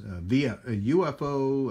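ordinary talk, nothing marked

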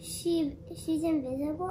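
normal talking voice

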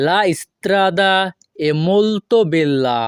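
very slow, careful Italian; slow model phrase. Shown as a demonstration. Teacher modelling the Italian slowly for students. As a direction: draw it out for learners. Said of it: "model then broken".

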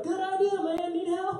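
high, loud mock drunk holler; drunk supporters. Drunk passers-by cheering on a runner, loud mock holler. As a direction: holler encouragement in a silly high voice. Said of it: "Drunk people, they're like".

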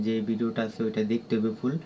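ordinary talk

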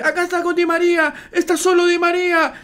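loud, very high play-by-play; high-pitched commentary. Shown as a demonstration. Imitating Clos before the goal, high-pitched commentary. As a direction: push the call up high and urgent. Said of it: "ahí está en agudo".

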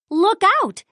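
high warning call. A narrator's reading. Cuckoo Loca warns of bouncing balls, high warning call. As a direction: warn them, high and quick. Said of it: "says Cuckoo Loca".

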